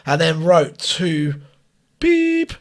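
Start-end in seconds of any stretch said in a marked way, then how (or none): 2.0-2.5 high, held beep made with the voice; imitated censor beep. Shown as a demonstration. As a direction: bleep out the name with a high tone. Stands in for censored name.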